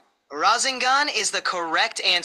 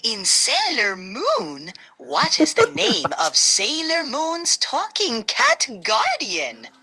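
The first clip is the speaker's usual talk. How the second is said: high-pitched fairy voice; 6 semitones higher, slower than usual. Acted. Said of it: "In a high-pitched fairy voice".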